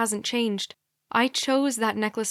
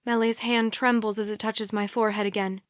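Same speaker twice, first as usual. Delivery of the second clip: plain reading voice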